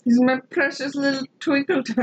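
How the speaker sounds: high doting coo; baby the pet with pet names; pet baby talk. Pretend. Cooing over the cat's nickname, baby talk.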